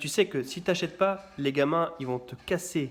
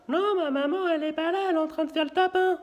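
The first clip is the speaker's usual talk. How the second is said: put-on much higher, little child on the phone; high childish voice fooling a salesman; kid's voice; 13 semitones higher than usual. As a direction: pretend to be a small kid. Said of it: "tu prends la voix d'un gamin".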